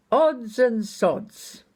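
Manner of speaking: high, drawn-out cheery call-out; loud, cheerful call. Retold or imitated. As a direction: call it out slowly and merrily, imitating a rowdy straggler. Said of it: "in a loud and cheerful voice".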